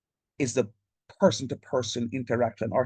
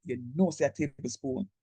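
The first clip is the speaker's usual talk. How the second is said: ordinary talk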